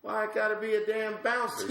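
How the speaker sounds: high, rising indignant complaint; hammed-up disbelief; mock outrage. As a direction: play it offended at being the bouncer. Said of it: "I said".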